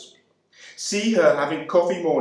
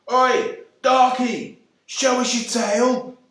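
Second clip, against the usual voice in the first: put-on jeering child's yell; 11 semitones higher, slower than usual. A narrator's reading. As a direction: yell it up at him like a cheeky urchin. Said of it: "who yelled up at him".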